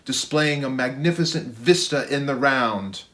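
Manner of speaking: plain reading voice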